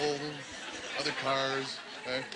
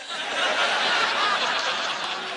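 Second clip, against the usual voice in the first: high cackle; 4 dB louder than usual. Pretend. Imagined Clinton burning.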